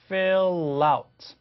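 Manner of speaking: "Fill out" drawn out as fil-lout; linking demo. Shown as a demonstration. Teacher repeating the link, "Fill out" slowly joined. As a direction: stretch "Fill out" without breaking it. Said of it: "loud가 되는 거죠".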